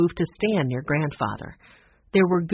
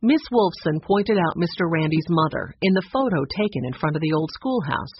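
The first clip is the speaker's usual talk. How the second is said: ordinary delivery, nothing marked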